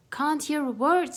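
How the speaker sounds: loud high mock scream. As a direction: shout over the noise. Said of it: "screamed Melissa".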